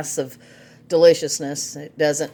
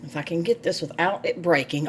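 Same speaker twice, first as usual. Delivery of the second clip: normal talking voice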